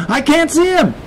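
very high, panicked cry; screaming kid. A narrator's reading. Kid searching the waves for Dad, screaming. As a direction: cry it out in alarm. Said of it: "Then he screamed again".

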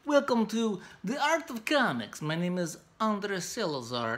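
a deliberately bad Russian accent for a show intro; over-the-top mock Russian accent; horrible Russian accent. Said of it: "Sorry about my horrible Russian, uh, accent".

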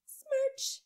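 high, loud smacking kiss; a big, high smooch noise; loud kiss sound. A narrator's reading. Grandma kissing Andrew goodnight, high loud smack.

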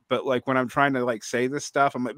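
ordinary talk, nothing marked

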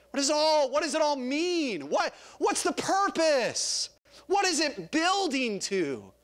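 high, slowing rhetorical questions; insistent preaching. Real, not acted. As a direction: press each question on the congregation.

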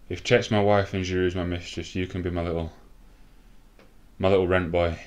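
ordinary talk

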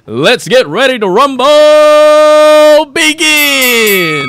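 very drawn-out, high, loud fight call; ring announcer bellow. Pretend. Announcer starts another boxing event, long loud bellow. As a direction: bellow it out, holding RUMBLE.

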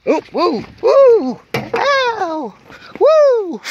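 high pained yelps from a burn; real ouch, shaking off the burn; burnt finger yelps. Real, not acted. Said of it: "And I burnt my finger".

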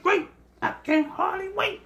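high mock-eager sarcasm; sing-song sarcastic delight. Real, not acted. Sarcastic remark about phone.

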